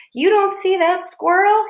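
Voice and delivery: high, loud, slow amazed question; lightly voiced boy pointing up at the tree; excited pointing. A narrator's reading. Excited kid pointing at squirrel.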